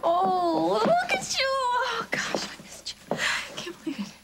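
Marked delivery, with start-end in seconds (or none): 2.1-4.1 choked whisper. Acted. Tearful family reunion.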